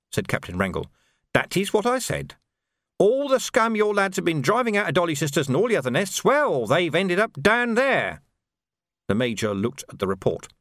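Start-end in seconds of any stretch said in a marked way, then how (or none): 1.3-8.2 menacing Carcer with a sly, amused edge; sneering sergeant. Acted. Carcer taunting the Major about the burned watch house, sly. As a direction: needle the officers with mock concern.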